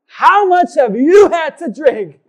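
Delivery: very loud, high, slow and incredulous; incredulous outburst. Retold or imitated. Man reacting to tipsy woman's compliment, incredulous. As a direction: drag out each word in loud disbelief.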